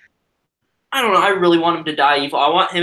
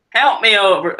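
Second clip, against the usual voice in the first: loud, higher mock Southern Leia; Southern accent; 5 semitones higher, 5 dB louder than usual. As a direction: belt it like a hammed-up Southern belle. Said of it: "I mean, I'm Southern".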